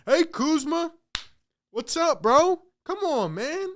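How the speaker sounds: high, chummy mock coach greeting; mocking Doc. Fan mocking Doc greeting the rival players, high and chummy. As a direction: lightly play the coach buddying up. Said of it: "he's walking up".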